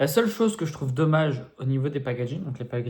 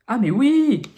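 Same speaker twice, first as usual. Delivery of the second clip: sudden realization; 10 semitones higher, 9 dB louder, slower than usual. Real, not acted. Realizes packaging mistake.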